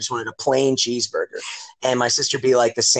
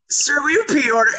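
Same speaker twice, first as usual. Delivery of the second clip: high-pitched mock cashier; high imitation of a fast-food worker; drive-thru kid; 13 semitones higher than usual. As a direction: put on a high teen cashier voice. Drive-thru kid asks again.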